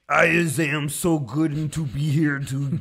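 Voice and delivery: a lightly put-on dim voice, reading clumsy words; mock illiterate comment. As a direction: parody his garbled comment. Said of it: "He's like".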